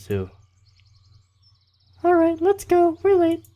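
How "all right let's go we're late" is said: loud falsetto camp leader hurrying someone along; badly faked, very high female voice; bad girl voice. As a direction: urge them on in squeaky falsetto. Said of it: "That was a really bad girl voice".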